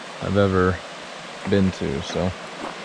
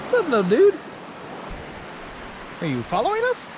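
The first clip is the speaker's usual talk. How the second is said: talking to a critter; 14 semitones higher, 6 dB quieter, faster than usual. Real, not acted. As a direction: coo at the little animal, high and soft. Talking to a chipmunk.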